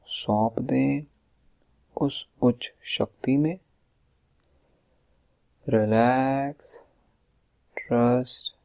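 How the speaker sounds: slow, drawn-out meditation voice with long pauses; guided meditation. Acted. Leads a relaxation meditation.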